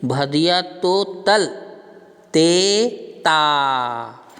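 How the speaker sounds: very slow drawn-out lisp, भदिया तो तल ते ता with t for k and kh; lisping baby talk. Shown as a demonstration. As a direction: stretch it out like a lisping toddler. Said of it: "तो इसको तुतला कैसे बोलेंगे?".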